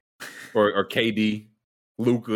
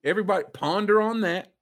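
mock sage; 11 semitones higher than usual.

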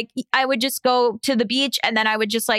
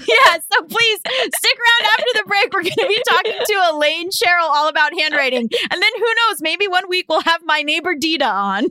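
bright, raised announcer read; podcast host outro; 6 semitones higher than usual. Acted. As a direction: sign off to the listeners. Announces the break.

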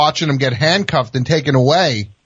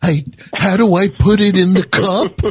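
panicked fake cook; 8 semitones higher than usual. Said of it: "be like".

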